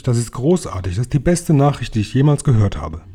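ordinary delivery

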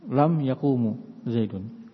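yaqumu said slowly with a final u; stretched yaqumu with the mistaken end vowel; wrong indicative reading. Shown as a demonstration. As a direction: say yaqumu, sounding mu before Zaidun. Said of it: "Khata, keliru".

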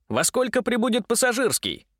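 high, bright eager question; perky. A narrator's reading. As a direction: ask it with cheerful zest. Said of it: "задорно спросил Митя".

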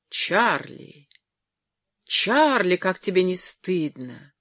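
scandalised cry, rising on the second call; involuntary exclamation. A narrator's reading. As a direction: exclaim in shocked reproach. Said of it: "не могла удержаться от восклицания".

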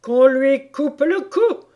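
high, loud, drawn-out royal command; furious yell. A narrator's reading. The queen ordering Alice's beheading, yelling furiously. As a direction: bellow the order as an enraged queen. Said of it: "hurla la reine".

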